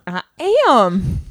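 drawled Southern "I am" with "am" stretched over two syllables. Shown as a demonstration. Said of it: "I am" was very Southern".

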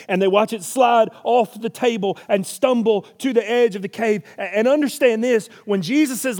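loud, high preaching build; full-force preaching. Acted. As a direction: drive the story home from the pulpit.

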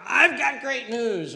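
high, loud and slow "I've got great news"; excited news. Shown as a demonstration. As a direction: announce good news with joy. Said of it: "when we want to just say to somebody".

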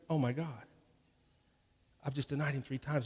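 low, hushed self-reproach; stricken realization. Acted. Peter realizes he has denied Jesus, stricken and hushed. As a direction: murmur it as Peter, crushed by it.

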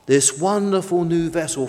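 plain reading voice

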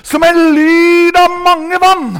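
high, loud, long-held preaching cry; stretched full-voice scripture shout; thunderous proclamation. Shown as a demonstration. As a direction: roar the verse out slowly like rushing waters. Proclaims the roaring heavenly sound.

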